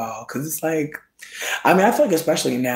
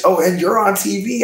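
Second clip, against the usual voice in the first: higher, bright announcer with a rising finish; mock TV host; 7 semitones higher, 5 dB louder than usual. Gaslighting onlookers after a chair breaks, a high rising TV-host reveal. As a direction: sell it like a game-show reveal, ending up. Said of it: "I'm gaslighting everybody".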